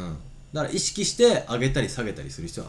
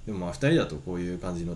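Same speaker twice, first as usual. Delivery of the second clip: lower, lazy everyday voice; deep low voice; 5 semitones lower, 4 dB quieter than usual. Shown as a demonstration. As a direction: drop into a tired low voice. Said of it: "ここまで低くはないけど".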